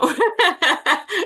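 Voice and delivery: a real, light laugh breaking out. Real, not acted. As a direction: laugh along with the guest. Host laughs with guest.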